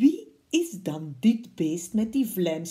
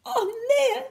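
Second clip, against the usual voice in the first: much higher, alarmed cry; frightened fox; 6 dB louder than usual. Acted. Fox sees the Gruffalo and cries out in alarm. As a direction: yelp it in sudden fear. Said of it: "riep hij".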